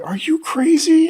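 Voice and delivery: high, slow disbelief; incredulous. Retold or imitated. Boy staring at grandfather, high-pitched disbelief. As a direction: stretch it out, can't believe it. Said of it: "I looked at him like".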